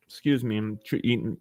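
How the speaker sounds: talking with mouth full; speaking around a bite of food. Real, not acted. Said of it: "talking with my mouth full".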